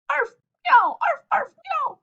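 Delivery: squeaky yaps and meows, loud; high pet noises; high loud animal noises. Acted. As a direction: yowl like cat and puppy together. Cat and dog noises.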